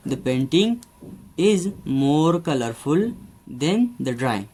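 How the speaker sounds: very slow, word by word example sentence; slow dictation. Shown as a demonstration. Teacher dictating an example to students, very slow. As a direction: spell it out slowly for the class.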